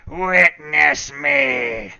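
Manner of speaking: slow, drawn-out shouted creepy chant; creepy acolyte shout. Acted. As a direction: howl it like a cultist's call. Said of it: "One more time".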